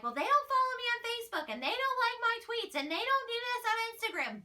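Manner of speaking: mocking entitled fans. Imitating fans upset at celebrities, high mock whine. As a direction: whine it like a spoiled fan who feels ignored. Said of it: "It's so annoying when they're like".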